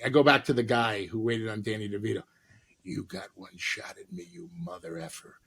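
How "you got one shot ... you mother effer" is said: hushed threat. Retold or imitated.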